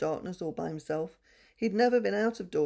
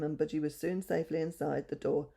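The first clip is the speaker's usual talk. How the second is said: ordinary delivery, nothing marked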